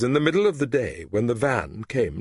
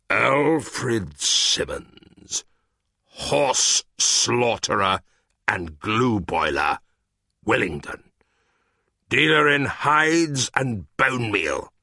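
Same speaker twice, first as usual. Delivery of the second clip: very slow, heavy reading of the sign; grim reading aloud; 2 semitones higher, slower than usual. A narrator's reading. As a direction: spell out the horror word by word. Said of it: "he read".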